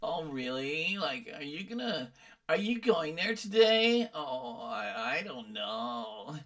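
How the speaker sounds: put-on whiny, creaky East Coast college girl; bored, whiny spoiled-girl drawl. Shown as a demonstration. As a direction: drawl lazily with twang and fry. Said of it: "spoiled college girl voice".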